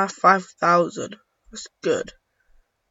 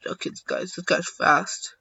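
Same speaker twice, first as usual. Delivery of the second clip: ordinary talk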